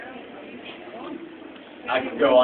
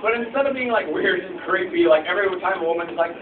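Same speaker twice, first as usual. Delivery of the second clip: usual speaking voice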